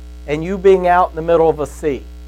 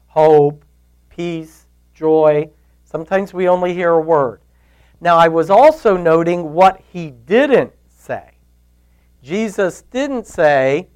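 usual speaking voice

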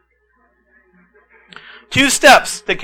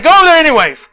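mock coach; 9 semitones higher, 6 dB louder, faster than usual. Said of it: "And he's like".

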